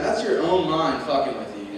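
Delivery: ordinary talk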